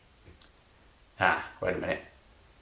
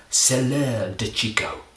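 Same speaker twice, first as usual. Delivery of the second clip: proud French answer; 4 semitones higher, 5 dB louder, slower than usual. Retold or imitated. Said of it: "put my hand up and go".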